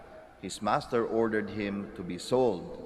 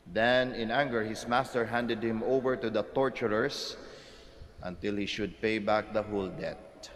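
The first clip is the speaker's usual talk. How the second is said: ordinary talk, nothing marked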